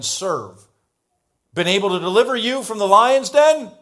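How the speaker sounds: high, loud, anxious calling out; a king shouting his fear into the den; troubled cry. A narrator's reading. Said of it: "with a troubled voice".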